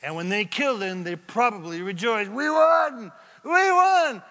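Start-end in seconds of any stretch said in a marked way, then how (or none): 2.3-4.2 high, loud victory shout; gloating shout. Retold or imitated. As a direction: yell it like a gloating demon. Demons celebrating the crucifixion.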